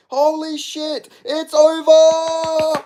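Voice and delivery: high, drawn-out slow shouting; excited slow yell. Real, not acted. Fan calling the fight over, slow high yell. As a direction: stretch every word out in shock.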